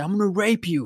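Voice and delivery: high-pitched mock teenage rage; re-enacted pissed-off yelling. Teenager cursing his winning buddy, mock pissed off. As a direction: act out a half-volume gamer tantrum. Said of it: "I would say crazy shit like that".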